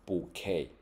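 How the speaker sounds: bouquet with no T sound, stressed on the end; silent final T. Shown as a demonstration. Teacher demonstrates the word to students, silent final T. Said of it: "这个T不发音哦，哈，重音在尾巴".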